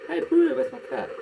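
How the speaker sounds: high, loud call across the room. Real, not acted. Calling to the brewer for a cup, loud and high. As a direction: shout for your cup.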